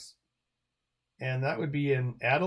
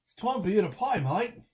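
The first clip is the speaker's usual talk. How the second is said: higher, quicker put-on Australian accent; real Australian accent; 7 semitones higher than usual. Shown as a demonstration. Streamer showing how a real Australian says it, higher Australian accent. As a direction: lift it into a fuller Australian twang. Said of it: "if you're really Australian, it'd be like".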